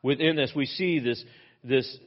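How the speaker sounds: normal talking voice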